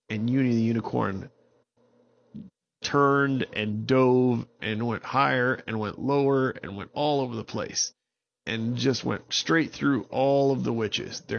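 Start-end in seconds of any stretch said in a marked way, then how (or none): none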